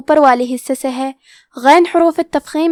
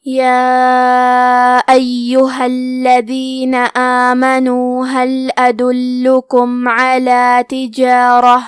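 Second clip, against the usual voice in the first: slow, drawn-out tarteel recitation; Quran recitation; 2 semitones lower, much slower than usual. Acted. Teacher recites the verses for students, slow tarteel. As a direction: let every vowel stretch, measured and melodic.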